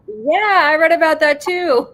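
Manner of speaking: high, loud mock-innocent voice; feigned innocence. Telling the reporter she read it too, feigning innocence. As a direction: play dumb, all innocence. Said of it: "And I was like".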